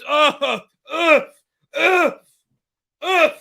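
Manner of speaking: high, loud, spaced-out cries of awe; astonished grunts. Real, not acted. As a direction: let out repeated high yelps of disbelief. Overcome by the goal.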